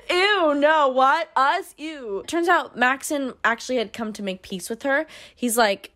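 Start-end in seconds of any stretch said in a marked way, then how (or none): 0.1-2.2 mock grossed-out. Teased about being a cute couple, mock disgusted squeal. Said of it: "and the other person's like".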